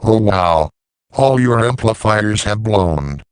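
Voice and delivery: normal talking voice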